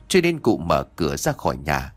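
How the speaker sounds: usual speaking voice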